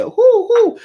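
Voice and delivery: playful high owl hoot on "Who, who"; loud owl hoot. Shown as a demonstration. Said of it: "I used to always do that".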